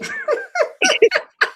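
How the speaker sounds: high squeaky laugh through tears; teary high laugh. Real, not acted. Guest fighting tears on a podcast, squeaky laugh. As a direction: laugh it off while welling up.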